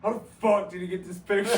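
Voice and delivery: loud, high, laughing outburst of disbelief; shocked disbelief. Retold or imitated. Corey reacting loud and laughing at the photo. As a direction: blurt it out amazed and laughing. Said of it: "Corey's like".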